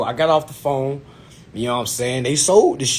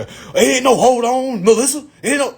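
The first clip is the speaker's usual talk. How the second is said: hot-headed; 9 semitones higher, 4 dB louder than usual. Retold or imitated. Applicant refusing to hold for Melissa, hot-headed. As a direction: imitate himself refusing to be put off. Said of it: "I was in my hot head".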